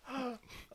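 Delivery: high startled gasp; mock gasp. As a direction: gasp as if caught off guard. Mocking Sam's reaction.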